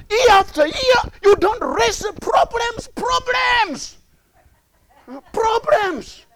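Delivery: driving list climbing in pitch; mounting revival-sermon cadence; impassioned preaching. Real, not acted. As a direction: build steadily to a high preacher's cry. Preaches with rising intensity.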